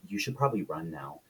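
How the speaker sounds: low, hushed menace; creepy. Retold or imitated. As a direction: softly deliver an eerie warning. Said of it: "sitting there being creepy".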